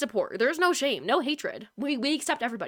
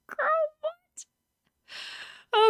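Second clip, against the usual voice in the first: shocked squeal. Real, not acted. Streamer reacting to a shocking game twist, high shocked squeal. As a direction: jump up in pitch in disbelief. Stunned by husband reveal.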